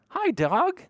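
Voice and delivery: very high-pitched little hello; cutesy greeting. Pretend. Storyteller greeting the felt dog, cutesy greeting. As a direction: greet a toy in a tiny high voice. Greeting a toy animal for children.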